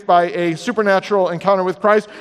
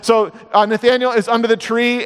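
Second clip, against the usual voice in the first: normal talking voice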